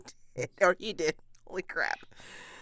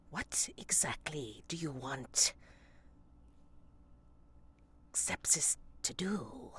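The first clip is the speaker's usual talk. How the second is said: whispered aside; 7 dB quieter than usual. Acted. Player pulls Bertha aside, whispering. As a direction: murmur so Sepsis can't hear. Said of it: "pulls Bertha aside ... and goes".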